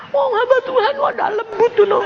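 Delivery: very high, whiny complaint; high mock wail; imitated crying visitor. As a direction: mimic a tearful complainer, hammed-up. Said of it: "Dia bilang".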